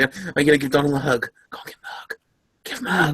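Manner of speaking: hushed, coaxing whisper; whispering to Trump to give the woman a hug; mock whisper. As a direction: egg him on under your breath. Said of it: "he was like".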